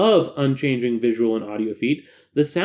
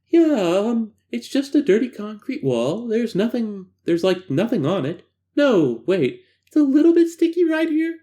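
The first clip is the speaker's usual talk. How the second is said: voiced female subject; 6 semitones higher than usual.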